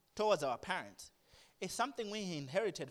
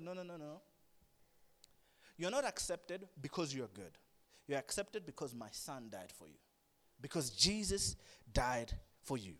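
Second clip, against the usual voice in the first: gentle God voice; 5 semitones lower than usual. Retold or imitated.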